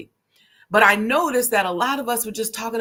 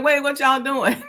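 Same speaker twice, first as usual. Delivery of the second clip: playful mock protest; 5 semitones higher, 4 dB louder than usual. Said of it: "But I'm like".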